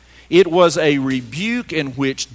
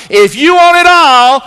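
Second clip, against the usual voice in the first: loud, high, fired-up call; enthusiastic call; 12 semitones higher, 15 dB louder than usual. Shown as a demonstration. Pastor leading the call and response, enthusiastic. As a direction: throw it out to the crowd with energy. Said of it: "say with enthusiasm".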